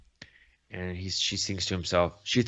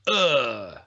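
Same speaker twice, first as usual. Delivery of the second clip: mock "ugh" of being hit; short hammed-up grunt; acted pain groan; 3 semitones higher, 4 dB louder than usual. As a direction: groan as if going down wounded. She goes down, shot in neck.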